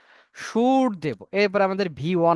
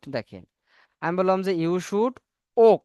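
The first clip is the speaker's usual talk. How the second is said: ordinary talk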